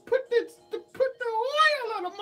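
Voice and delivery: high, loud hammed-up preaching; mock preacher blessing. Vlogger playfully blessing brows like a preacher.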